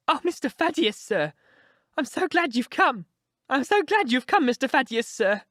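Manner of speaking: put-on high, relieved old housekeeper; rejoicing old woman. A narrator's reading. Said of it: "We heard her reiterate rejoicings".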